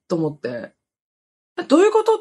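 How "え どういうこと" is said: high-pitched baffled question; reenacted bewilderment. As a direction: replay your confused surprise, voice shooting up. 状況が分からず驚く再現. (高い声で戸惑いを再現)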